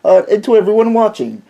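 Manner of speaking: high, posh-parody British greeting; hammed-up British accent. Guest vlogger greets viewers in a mock British accent. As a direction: put on a jokey British accent to say hello. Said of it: "my British accent does sound a little".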